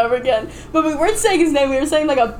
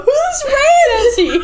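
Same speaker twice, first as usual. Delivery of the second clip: much higher, laughing; laughing question; 5 dB louder than usual. Retold or imitated. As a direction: ask it through laughter, high up. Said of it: "is where I go".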